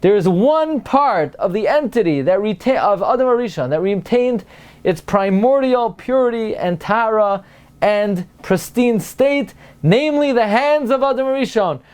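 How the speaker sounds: high, emphatic sermon conclusion; rousing, excited preacher driving the point home; fervent preaching. Acted. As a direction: proclaim it from the pulpit, pitched well up.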